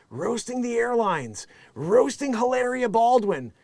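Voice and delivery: ordinary talk, nothing marked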